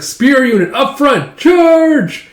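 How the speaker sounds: put-on high, loud kid's war cry; rally the troops, soaring on "charge"; play battle command. Child commanding a pretend spear unit, battle cry. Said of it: "are all playing pretend".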